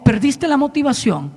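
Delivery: a hint of calm divine rebuke; lightly voiced tender answer; God's gentle correction. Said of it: "Y me dijo".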